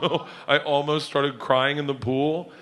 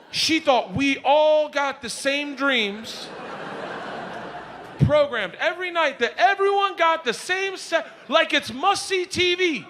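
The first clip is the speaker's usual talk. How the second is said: incredulous shouting; 9 semitones higher, slower than usual. Real, not acted. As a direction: build to a shout of disbelief. Incredulous rant building up.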